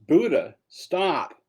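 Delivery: high, loud, drawn-out retold yell; frustrated yell. Murderer yelling after the Buddha out of frustration, loud yell. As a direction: shout him to a halt in frustration. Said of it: "Angulimala yells".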